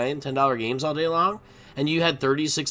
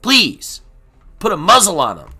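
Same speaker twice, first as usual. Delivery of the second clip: loud rant; 4 semitones higher, 6 dB louder than usual. Real, not acted. Ranting at poker nerds, loud and demanding. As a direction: demand it loudly.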